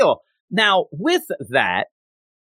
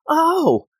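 surprised; 8 semitones higher than usual. Retold or imitated. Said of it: "And so then he".

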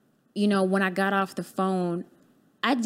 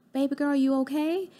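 a light higher mother's voice, checking on her daughter; mom's voice; 7 semitones higher than usual. Retold or imitated. Mom calling asks her daughter if she's okay, lightly higher mother's voice.

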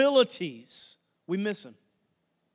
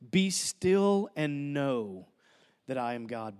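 slow, low, soft reading of the verse; solemn scripture; 8 semitones lower, 3 dB quieter, slower than usual. Acted. Preacher reads Psalm forty-six ten to the congregation, slow and low. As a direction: let each word settle, unhurried.

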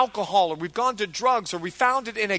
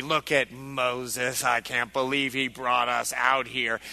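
grumbling crowd; 10 semitones lower, 3 dB quieter, slower than usual. Acted. Angry people voiced by pastor.

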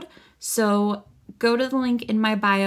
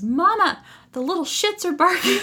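put-on high little-kid voice, amused; high-pitched child's announcement, tickled; Rocky; 12 semitones higher, 6 dB louder than usual. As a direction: imitate a small child calling out, laughing through it. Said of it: "he goes".

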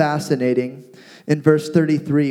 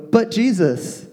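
higher-pitched acted objection; mock protest; 6 semitones higher than usual. Onlookers objecting to Jesus at the tomb, mock protest. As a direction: imitate onlookers pleading an objection.

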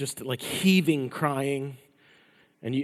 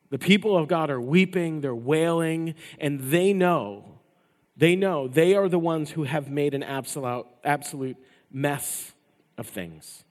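louder, driving sermon delivery; forceful preaching; 3 semitones higher, 6 dB louder than usual. Acted. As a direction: preach it hard to the congregation.

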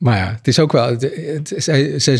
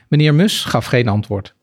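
ordinary talk

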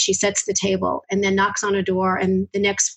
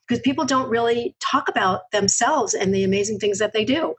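ordinary talk

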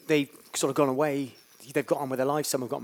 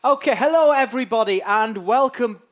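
showy welcome; 7 semitones higher, 10 dB louder than usual. Acted. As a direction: play the big-stage host. Second go at a big welcome.

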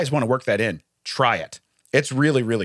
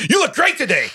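loud, high, forceful compliment; hammed-up aggressive praise; 11 semitones higher, 4 dB louder than usual. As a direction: bark the compliment like an accusation. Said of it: "I said it just like that".

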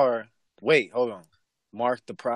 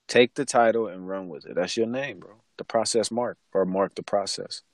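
ordinary talk

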